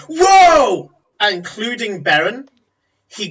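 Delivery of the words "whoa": loud, high cry of shock; acted outburst, pitched way up; shocked whoa. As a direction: exclaim like a startled crowd. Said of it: "an act that gets everybody going".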